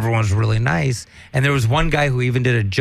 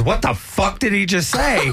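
outraged woman; 7 semitones higher than usual. Retold or imitated.

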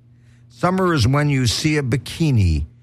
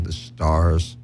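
normal talking voice

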